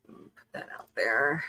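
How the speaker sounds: whispered under the breath; whispered aside. Real, not acted. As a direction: drop to a quick whisper. Quiet aside during show intro.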